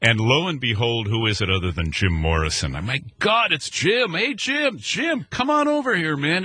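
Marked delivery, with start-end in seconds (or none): 3.2-6.4 high excited call across the beach; calling out to Jim. Retold or imitated. Calling out to a friend down the beach, excited and high. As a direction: hail him from a distance, pitched way up.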